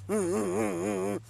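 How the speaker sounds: low, soft closed-mouth hums; sullen mock humming. Pastor's wife refusing to say amen, sullen soft humming. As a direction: hum tight-lipped in mock sulk.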